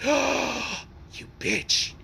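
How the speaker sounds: high, airy mock outrage; scandalized gasp. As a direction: say it breathily, thrilled and shocked. Friend's scandalized reaction, acted.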